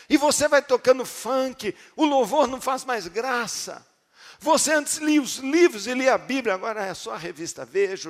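loud, high preaching with a sung lilt; fiery preaching. Acted. Preaching at full force.

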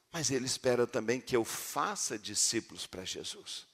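ordinary talk, nothing marked

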